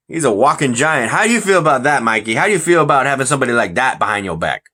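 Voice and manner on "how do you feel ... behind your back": ordinary talk, nothing marked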